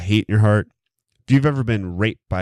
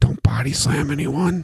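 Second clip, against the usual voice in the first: pleading with himself; 6 semitones higher than usual. Pretend. Talking himself through turkey giblets.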